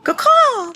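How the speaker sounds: loud, high bird cry caw-caw; shrill crow call. Retold or imitated. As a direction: squawk it like an excited crow. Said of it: "mimicking the bird shapeshifter".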